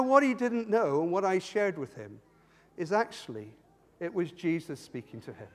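ordinary talk, nothing marked

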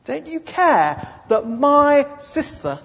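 high, loud, slow reproach; indignant accusation. Acted. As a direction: stare him down as you accuse. Martha accusing Jesus.

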